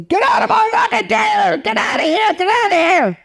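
very high, strained mock scream; shrieking impression of a tantrum; imitated screaming. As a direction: screech it like a furious diva, without full volume. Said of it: "she started screaming at me".

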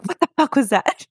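mock freak-out at a sudden noise. Telling how they heard a child's laugh in the store, freaked out. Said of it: "Just freaked out".

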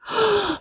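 sharp high gasp of outrage; lady gasping at defiance; indignant gasp. A narrator's reading. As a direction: gasp in shock.

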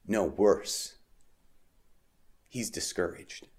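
hushed, weighty answer; grave reply. Acted. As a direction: drop very soft and slow for the bad news. Voicing the angel's grave answer.

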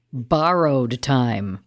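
borrowed as Barrow-ed, low and drawn out; Barrow pun. Shown as a demonstration. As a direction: say borrowed slowly to sound like the name Barrow. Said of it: "Or should we say".

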